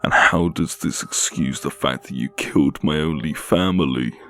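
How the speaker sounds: loud, high furious question; angry accusation. Acted. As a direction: throw it at him. Captive angrily accuses killer.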